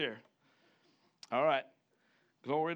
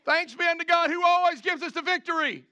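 triumphant proclamation; 10 semitones higher, 7 dB louder than usual. Real, not acted. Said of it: "preaching triumph repeated".

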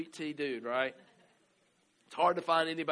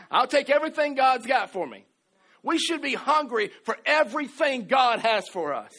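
fiery preaching; 9 semitones higher, 12 dB louder than usual. Acted. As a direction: drive it home to the congregation, full force. Preaching at full force.

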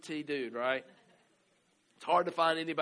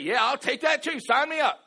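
loud, high, thrilled cry of acceptance; eager shout; 11 semitones higher, 11 dB louder than usual. Retold or imitated. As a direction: shout it like you can't wait to sign up. Disciples eagerly accepting the Spirit.

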